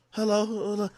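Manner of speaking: softly imitated drunk phone greeting; mock a drunk, muted hello. Girlfriend answering the phone very late, imitated drunk. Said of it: "she sounds beyond drunk".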